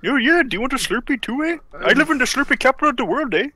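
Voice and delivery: mock Canadian. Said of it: "It sounds like you're about to say".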